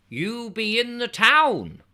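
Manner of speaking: slow, high rural West Country voice; rustic dialect. Acted. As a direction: drawl it like a village local. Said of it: "I have been informed".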